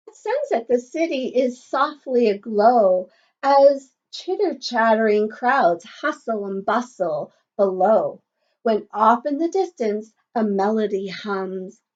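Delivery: lilting, sing-song picture book read; reading to children. Acted. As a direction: read the rhyme aloud to a class. Reading rhyme to class.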